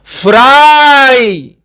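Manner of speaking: فرائی spoken very slowly, each sound clear; slow model word. Shown as a demonstration. Teacher modelling the word slowly for students. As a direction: stretch فرائی out for learners. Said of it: "اس کا تلفظ".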